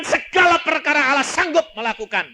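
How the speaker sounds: high, loud pulpit shout; proclaiming shout. Acted. Preacher proclaiming to the congregation, shouting. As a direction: belt it out as a proclamation.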